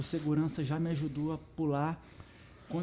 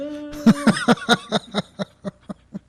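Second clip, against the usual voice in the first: loud, high burst of laughter; big laugh; 10 semitones higher, 9 dB louder than usual. Real, not acted. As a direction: laugh it all out. Laughs at his own joke.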